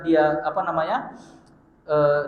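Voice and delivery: usual speaking voice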